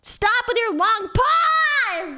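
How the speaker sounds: high, loud, slow protest; annoyed shout. Real, not acted. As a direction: yell at the friend to hurry up. Annoyed at friend's teasing pause.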